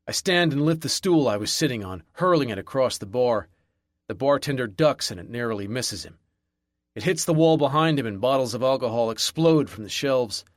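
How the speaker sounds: ordinary delivery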